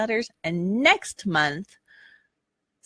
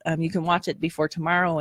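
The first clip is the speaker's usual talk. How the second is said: ordinary delivery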